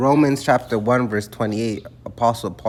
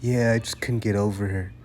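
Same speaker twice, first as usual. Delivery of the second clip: sheepish friend; 4 semitones lower, 6 dB quieter than usual. Retold or imitated. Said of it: "He's like".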